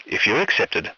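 ordinary talk

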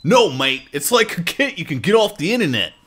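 put-on Australian voice, punched up; hammed-up Aussie accent. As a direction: read it as a broad Aussie.

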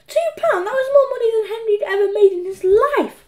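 high, thrilled narration; amazed glee. A narrator's reading. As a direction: marvel at sudden riches in a high voice. Henry amazed at the money.

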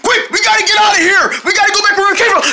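high, fast panicked yell; urgent shout. Acted. Deer urging friends to flee, fast high shout. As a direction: hurry everyone home. Fleeing dinosaur.

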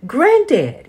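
high, loud call for granddad; yelling boy. Acted. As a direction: shout across the farm for granddad. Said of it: "yelled the boy".

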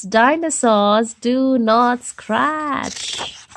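very slow triumphant recital; slow victorious motto; slow proud rule. Acted.

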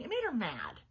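ordinary delivery

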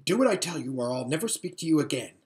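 ordinary delivery, nothing marked

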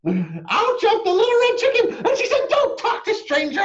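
high loud cry with a laugh; excited outburst. A narrator's reading. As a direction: interrupt giggling and shouting. Chicken bursts in laughing.

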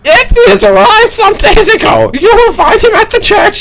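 put-on a very high, strained old abbot, slow at the end; squeaky abbot voice. The abbot sends the monk to the church, squeaky and slow.

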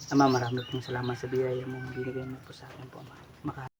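murmured grace before eating, very soft and low; quiet prayer. Real, not acted. A vlogger murmuring grace over breakfast, hushed and low. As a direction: softly thank God before the meal. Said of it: "Pasalamat muna tayo".

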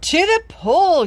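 high, loud, slow call "to the pool"; firemen's yell. Acted. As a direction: shout out the answer. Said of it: "yelled the firemen".

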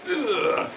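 short high grunt of strain; a quick grunt as you heave something up; effort grunt. Real, not acted. Picking up a bundle of sticks.

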